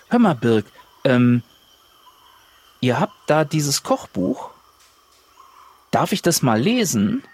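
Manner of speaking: ordinary talk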